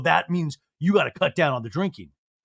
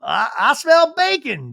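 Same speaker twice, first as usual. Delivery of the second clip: slow, higher, crowing voice; rooster-like crow; 7 semitones higher, 5 dB louder, slower than usual. Retold or imitated. Daniel entering the kitchen, crowing like a rooster. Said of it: "doing a rooster noise".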